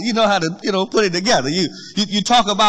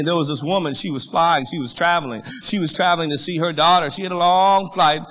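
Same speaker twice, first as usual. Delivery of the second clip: low, soft anecdote voice, drawing them in; quiet storytelling; 3 semitones lower, 3 dB quieter than usual. Acted. Preacher telling the congregation a story in a hushed voice. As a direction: lean in and tell it gently.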